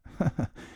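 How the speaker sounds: short, high nervous chuckle; nervous laugh. Retold or imitated. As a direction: laugh off the unease. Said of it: "I nervously laughed".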